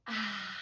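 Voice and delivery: soft, low breathed-out "ah"; calming exhale. Shown as a demonstration. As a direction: let the breath go gently. Models breathing out in story.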